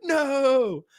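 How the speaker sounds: high wailed no easing into whiny pleading; hammed-up protest; mock dismay. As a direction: plead in playful outrage. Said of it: "you're like".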